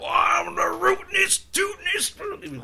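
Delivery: very high, loud, drawn-out cartoon gunslinger; Yosemite Sam. Imitating the cartoon cowboy's boast, hammed-up holler. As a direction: holler the boast, stretching every word. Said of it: "then there was Yosemite Sam".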